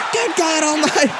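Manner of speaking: very high, full-throated commentator yell; Jim Ross shout. Retold or imitated. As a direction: scream it like a ringside call of a huge fall. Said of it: "he had the best reactions".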